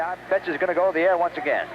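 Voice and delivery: usual speaking voice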